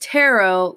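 TAROT drawn out slowly, ending in OH with no T; rhymed with sparrow. Shown as a demonstration. Teaching viewers to say tarot like sparrow, slowly. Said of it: "like how you say sparrow".

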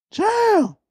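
Papa shouting from the shower; imitating Papa's yell. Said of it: "scared me right up on my sleep".

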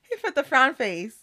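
slow, laughing through it. Real, not acted. Laughing as she tells her friend about the frown emoji. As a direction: giggle the words out.

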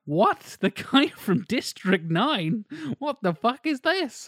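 high-pitched, drawn-out disbelief; incredulous reaction. Retold or imitated. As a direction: act out being utterly baffled, voice shooting up. Acting out baffled reaction to actor.

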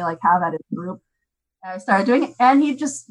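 ordinary talk, nothing marked

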